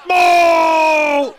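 sudden high yell of delight; excited shout. Real, not acted. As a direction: shout it out as the move hits. Wrestling move lands, friends yell.

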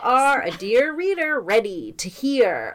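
slow, dramatic storyteller's build-up; theatrical show intro. Acted. Podcast host welcomes the reader to a wild tale, hammy and drawn-out. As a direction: draw out each word to tease the tale.

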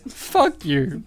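normal talking voice

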